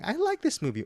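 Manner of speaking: parroting Bruce's parody back; lightly hammed-up echo; mock imitation. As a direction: mimic the mocking voice. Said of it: "you copy me and Eric saying".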